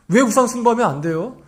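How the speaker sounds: ordinary talk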